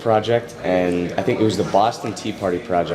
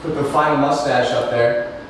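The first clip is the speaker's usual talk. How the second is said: lightly put-on announcer voice, pitched up; Tom Stingleby; 4 semitones higher than usual. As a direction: slip into your mock anchor voice. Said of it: "my Tom Stingleby voice".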